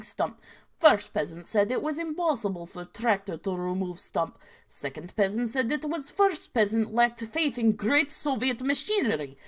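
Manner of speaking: cartoonishly Slavic storyteller in a Russian accent. Telling a Soviet peasant tale, cartoonish Russian accent. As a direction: tell it in a hammed-up Russian accent. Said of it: "my Russian accent is probably really bad".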